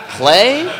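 as if the word makes no sense; incredulous. Real, not acted. Telling the audience his shock at the email, incredulous.

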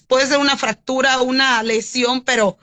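ordinary delivery

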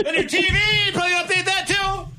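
high, strained pitch at the peak of the rant; a host venting about streaming apps to his co-host; exasperated rant. Real, not acted. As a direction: climb into full frustration. Rant peaks about streaming updates.

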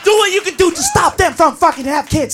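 usual speaking voice

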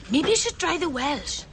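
usual speaking voice

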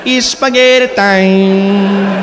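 slow, drawn-out dreamy whisper-tone; dreamy lullaby. Shown as a demonstration. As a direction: lull them to sleep with it. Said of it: "Say that catchphrase to yourself as you drift off".

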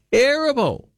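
"Terrible." flung out high and short; exclaimed verdict. Real, not acted. Host to listeners about the musical, high exclaimed scorn.

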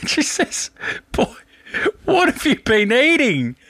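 high, squeaky voice breaking into laughs; helpless real laughter. Real, not acted. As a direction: struggle to get the punchline out through laughter. Breaks up laughing while telling story.

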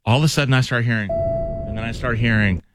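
normal talking voice

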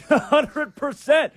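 high laughing agreement. Real, not acted. Laughing along with his co-host's joke. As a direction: laugh the words out slowly.

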